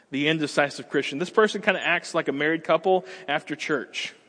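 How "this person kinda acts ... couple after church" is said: normal talking voice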